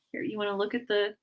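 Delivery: ordinary delivery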